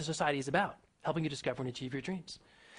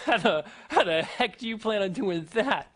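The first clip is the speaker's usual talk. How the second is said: laughing disbelief, slow and louder; mocking laugh; 4 semitones higher, 5 dB louder, slower than usual. Interviewer mocks Arnold's dream, laughing through it. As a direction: laugh the question in his face. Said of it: "laughs in his face and says".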